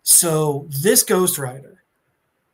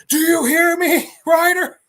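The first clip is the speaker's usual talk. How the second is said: higher, louder furious yell; angry Thanos shout; 10 semitones higher, 6 dB louder than usual. Retold or imitated. Thanos yelling after Ghost Rider, higher and louder. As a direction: bellow at the rider who ignores you. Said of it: "I love he goes".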